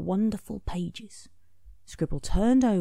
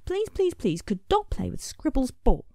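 Dog begging; 7 semitones higher than usual. A narrator's reading.

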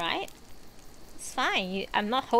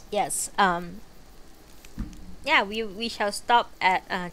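ordinary delivery, nothing marked

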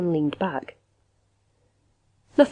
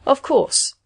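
higher, firmer recovery; stronger voice; 7 semitones higher than usual. A narrator's reading. Machine recovers from confusion, stronger voice. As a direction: regain confidence, pitched up. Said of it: "it went on in a stronger voice".

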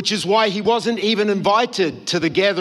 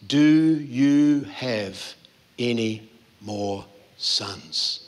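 slow searching question; 8 semitones lower, much slower than usual. Acted. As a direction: ask it gently, pausing, as Samuel probing Jesse. Samuel questions Jesse face to face.

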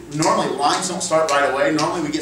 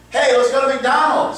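high, loud play-acted invitation; eager suggestion; 8 semitones higher, 4 dB louder than usual. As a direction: pitch the outing with mock eagerness. Acted scene opener in improv example.

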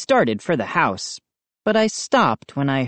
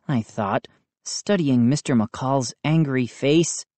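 ordinary delivery, nothing marked

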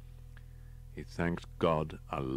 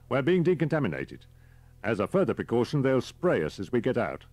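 higher, louder character voice; brief him like an efficient guide; briskly explaining driver; 6 semitones higher, 5 dB louder than usual. Acted. Driver explaining the decontamination to his passenger, brisk and raised.

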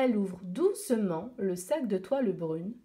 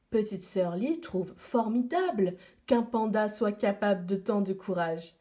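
plain reading voice

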